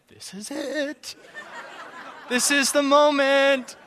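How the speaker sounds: high, slow, hammed-up big-moment voice; milk it like a movie climax, laughing at the end; mock dramatic.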